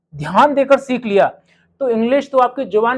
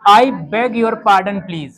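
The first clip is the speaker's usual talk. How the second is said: slow, careful polite request; polite slow pardon; much slower than usual. Shown as a demonstration. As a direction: spell out each word of "I beg your pardon, please" slowly and politely. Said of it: "English में क्या बोला जाता है". (धीमा, विनम्र "I beg your pardon, please")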